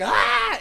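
sudden high yelp; startled yell. Retold or imitated. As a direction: jump out with a sharp yelp. Retold scare from the movie.

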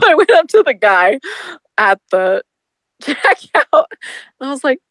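usual speaking voice

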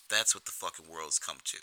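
usual speaking voice

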